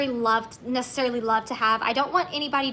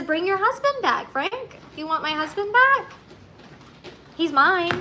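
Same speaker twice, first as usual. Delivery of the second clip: quoting husband; 5 semitones higher than usual. Retold or imitated. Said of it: "He says".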